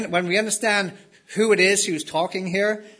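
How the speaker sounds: usual speaking voice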